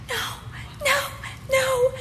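tiny high-pitched squeak of dread; acted horror in a small high voice; mock dismay. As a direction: squeak it out helplessly, spaced apart. Acted dismay at grandson's choice.

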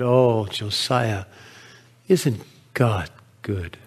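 slow, low, soft and tender; hushed wonder. Acted. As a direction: marvel softly and slowly at God's goodness. Sarah marvels at the beautiful sunset.

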